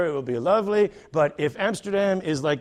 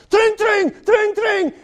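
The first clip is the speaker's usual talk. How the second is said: high, loud bell ring in the voice; bike bell imitation; 7 dB louder, slower than usual. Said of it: "here comes some guy".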